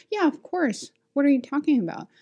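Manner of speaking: high, mock-cocky comeback; indignant retort. Teacher's unsaid answer to the coworker, indignant. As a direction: toss back an imagined put-down.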